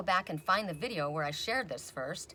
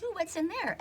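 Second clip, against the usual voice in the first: curious ooh; 12 semitones higher than usual. Retold or imitated. Voicing own curiosity opening drawer.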